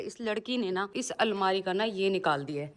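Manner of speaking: normal talking voice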